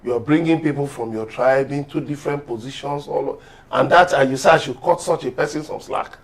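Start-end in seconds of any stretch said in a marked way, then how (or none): none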